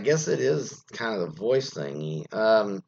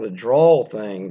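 "drawl" stretched long and slow, a touch louder; drawn-out drawl; 7 dB louder, slower than usual. Shown as a demonstration. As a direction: drag out "the drawl thing" like a Southern drawl. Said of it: "drawl, basically just draw stuff out".

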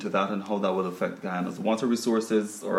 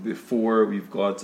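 usual speaking voice

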